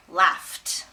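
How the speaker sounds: "laughed" slow, said as "laft"; careful demonstration of "laughed" ending in ft; f sound. Shown as a demonstration. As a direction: draw out "laughed" with f and a final t. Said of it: "That's a f sound".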